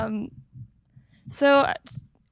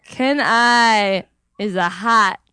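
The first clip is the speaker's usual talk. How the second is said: normal talking voice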